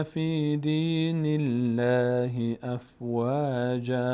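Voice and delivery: slow, drawn-out melodic recitation; Quran recitation. Acted. Teacher recites the whole sura for viewers, slow and melodic. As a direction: chant the sura in full tajwid.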